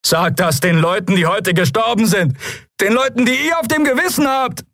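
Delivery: high, strained angry cry; furious accusation. A narrator's reading. Citizen blames the guards for the dead, furious shout. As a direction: hurl guilt at the captain.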